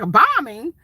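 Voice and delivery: loud, rising disbelief; shocked question. Retold or imitated. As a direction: blurt it back in alarm. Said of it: "I'm like".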